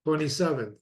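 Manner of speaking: ordinary talk, nothing marked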